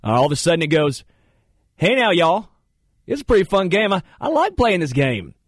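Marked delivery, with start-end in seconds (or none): 1.8-5.3 put-on Southern accent. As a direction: slip into a higher, folksy Southern twang. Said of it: "your voice changes so much".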